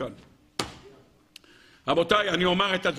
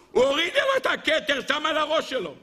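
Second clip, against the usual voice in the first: high, indignant protest; mock outrage; 10 semitones higher than usual. Councillors answering Jethro about the crown, mock outrage. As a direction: protest high, overacting the scandal.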